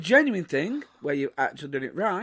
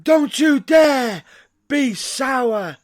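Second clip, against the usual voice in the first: hype announcer shout; 5 semitones higher, 7 dB louder, slower than usual. Acted. Hyped show intro announcement.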